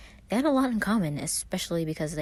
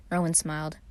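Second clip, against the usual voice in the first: ordinary delivery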